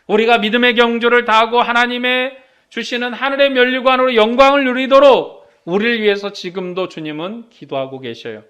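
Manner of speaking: ordinary delivery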